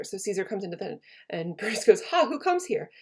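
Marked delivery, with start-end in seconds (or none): 2.0-2.9 high startled gasp of a question; startled cry. Acted. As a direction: jump at the sight of the ghost. Brutus sees Caesar's ghost.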